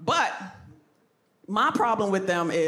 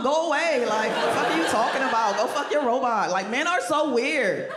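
ordinary talk

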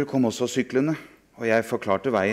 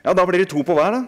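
put-on gleeful boy; 8 semitones higher, 7 dB louder, faster than usual. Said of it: "sa han".

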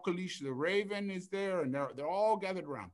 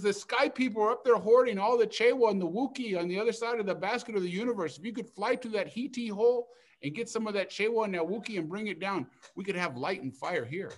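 eager plea; 5 semitones higher, 6 dB louder than usual. Acted. Oye pleads with Kalupi.

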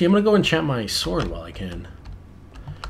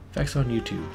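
ordinary talk

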